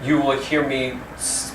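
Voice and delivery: normal talking voice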